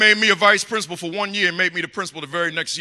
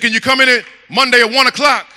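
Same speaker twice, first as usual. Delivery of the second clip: loud secretary; 2 semitones higher, 11 dB louder than usual. Retold or imitated. Secretary finally gives appointment.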